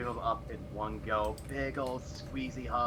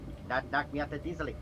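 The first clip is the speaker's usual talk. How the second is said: put-on playful boy voice; 6 semitones higher than usual. Boy character teasing kittens.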